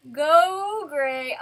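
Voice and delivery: a loud, high little cheer; root for him out loud. Real, not acted. Cheering the hero on.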